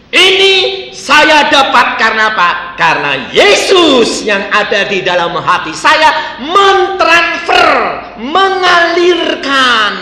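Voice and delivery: loud, high, slowing on key words; forceful preaching. Acted. Preacher exhorting the congregation loudly. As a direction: proclaim it with full conviction.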